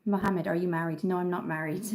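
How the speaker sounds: ordinary talk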